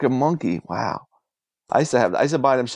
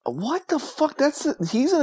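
higher, appalled "what the fuck"; stunned disbelief; 12 semitones higher, slower than usual. Real, not acted. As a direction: sound truly floored. Shocked by song lyrics.

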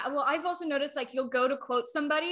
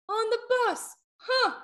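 higher-pitched, puzzled double take; mock disbelief; 10 semitones higher, slower than usual. Retelling own reaction to a bare butt on the bus, mock disbelief. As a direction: question it in mild disbelief.

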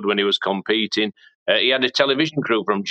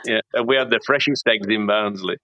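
ordinary talk

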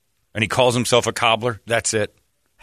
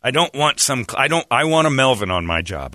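normal talking voice

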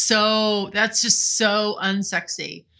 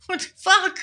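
high, alarmed mock panic; 12 semitones higher than usual. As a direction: act out a freakout, pitched up. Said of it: "I was like".

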